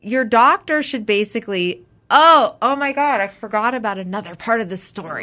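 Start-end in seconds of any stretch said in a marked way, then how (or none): 2.1-3.2 loud, slow, high oh my God; sudden realization. Real, not acted. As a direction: gasp it out as it dawns on you. Suddenly remembers a story.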